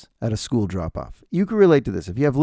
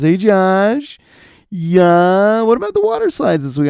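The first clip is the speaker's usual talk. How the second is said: higher, louder eager chirp; pushy, too-eager friendliness; overly social parent; 6 semitones higher, 6 dB louder, slower than usual. Retold or imitated. Overly social parent inviting Josh to water slides, loud eager higher voice. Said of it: "always wants to make plans".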